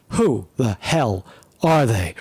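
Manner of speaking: usual speaking voice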